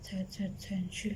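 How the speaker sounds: soft hushed whisper. Real, not acted. Speaker whispering to the child, hushed whisper. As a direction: whisper it so nobody hears.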